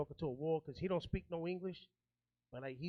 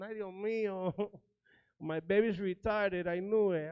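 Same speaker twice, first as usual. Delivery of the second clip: slow, hammed-up wailing mother; mock crying mom; 2 semitones higher, 4 dB louder, slower than usual. Son retells his mom crying over his diagnosis, slow mock wail. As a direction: drag it out like a weepy mom. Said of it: "She started crying again".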